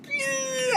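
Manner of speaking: very high sung ding; a bell going off; cartoon ding. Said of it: "it was like".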